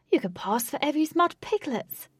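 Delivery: high, teasing jeer; mock cackle. A narrator's reading. Wraith teasing mud-covered friends, gleeful cackle. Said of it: "cackled Wraith".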